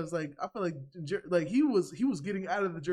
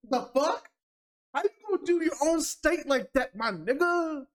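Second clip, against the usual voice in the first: higher and louder, disbelieving scold; exasperated outburst; 8 semitones higher, 5 dB louder than usual. Real, not acted. Fan scolding Jersey rappers for shaming their state, loud and high. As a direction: call them out in disbelief.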